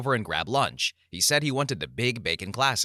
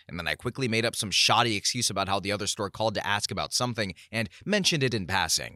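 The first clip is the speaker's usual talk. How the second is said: ordinary delivery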